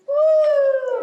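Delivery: high, loud "woo"; excited whoop. Real, not acted. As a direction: let out a long cheerful whoop. Kids' yoga, cheering after stretch.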